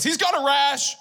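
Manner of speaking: high, loud blurt of the secret; wife exposing the rash to the doctor, loudly. Retold or imitated.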